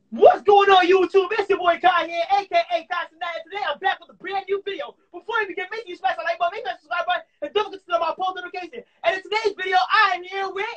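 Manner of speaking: high, rapid-fire YouTube intro voice; YouTuber intro. Acted. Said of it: "I'm gonna be like".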